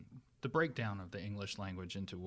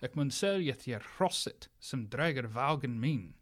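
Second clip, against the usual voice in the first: put-on Old Norse sentence in period pronunciation; Old Norse speech; 4 dB louder than usual. Shown as a demonstration. Norse settler in Yorkshire offering to sell a horse, Old Norse pronunciation. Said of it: "He would say".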